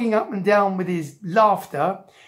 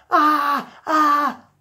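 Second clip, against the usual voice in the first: two high, loud acted cries; screaming lady; 7 semitones higher, 5 dB louder than usual. A narrator's reading. Lady on her knees in the crowd, screaming. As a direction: wail in short high bursts. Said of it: "on her knees screaming".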